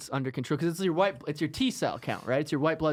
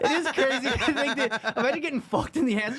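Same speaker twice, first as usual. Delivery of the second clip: ordinary talk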